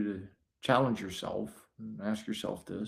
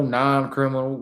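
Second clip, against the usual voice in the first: ordinary talk, nothing marked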